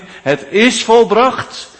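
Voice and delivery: high, loud and slow triumphant cry; preacher voicing Christ's loud cry; cried out. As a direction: call it out as a final victory. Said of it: "toen Christus het uitriep".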